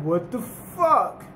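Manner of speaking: slow, stretched disbelief; staring up at something huge, slowly; drawn-out stunned what. Retold or imitated. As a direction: let it hang in slow awe. They see a giant wall.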